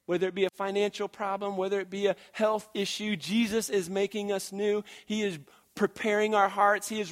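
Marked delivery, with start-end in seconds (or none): none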